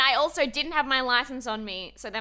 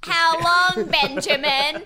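demanding shout; 7 semitones higher, 10 dB louder, slower than usual. Real, not acted. Friends pressing for an answer.

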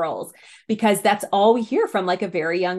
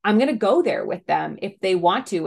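usual speaking voice

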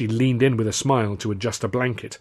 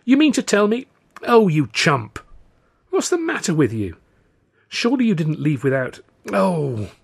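high, louder scolding of a dim friend; exasperated; 7 semitones higher, 5 dB louder than usual. A narrator's reading. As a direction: break off in exasperation. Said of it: "Amy was exasperated with me".

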